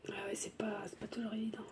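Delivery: tearful whisper; barely voiced, holding back tears. Real, not acted. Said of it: "très émotive".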